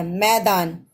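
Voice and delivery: usual speaking voice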